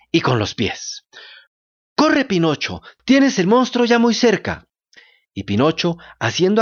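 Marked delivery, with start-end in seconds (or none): 2.0-4.7 urgent shout. A narrator's reading. Goat urging Pinocchio to flee.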